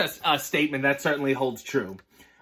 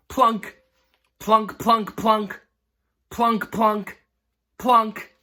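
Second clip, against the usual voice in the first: bouncy chanted plunks, a bit higher; playful sound effect; 4 semitones higher, much slower than usual. Acted. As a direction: drop each plunk like a falling apple. Reads apples falling sound words.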